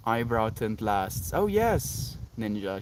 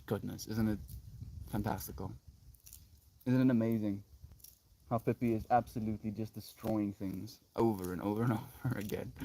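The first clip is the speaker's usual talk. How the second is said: hushed mock wonder; 2 semitones lower, 9 dB quieter than usual. Talking oneself into wonder at the dog's destruction, hushed awe. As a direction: marvel quietly, with long pauses. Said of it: "or you can be like".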